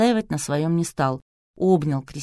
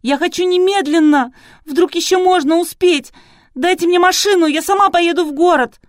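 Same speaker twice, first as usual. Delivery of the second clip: loud, high, frantic demands; flaring up; 13 semitones higher, 9 dB louder than usual. A narrator's reading. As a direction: insist at the top of your voice. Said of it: "снова взвилась Кристина". (истерично требуя, высоко и громко)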